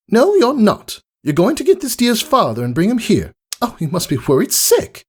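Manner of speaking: high, elegant and polite; gracious welcome; refined lady unicorn. Acted. Rarity greeting Rainbow Dash at her door, refined politeness.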